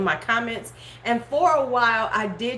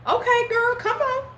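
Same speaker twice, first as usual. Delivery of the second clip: high mock exclaim; 13 semitones higher than usual. Real, not acted. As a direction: squeal it high and teasing. High exclamation reacting to comment.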